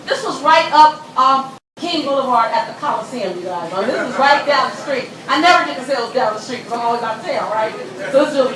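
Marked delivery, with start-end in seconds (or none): none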